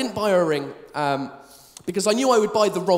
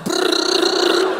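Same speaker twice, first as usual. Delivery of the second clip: scanning noise; 7 semitones higher, 3 dB louder than usual. Retold or imitated.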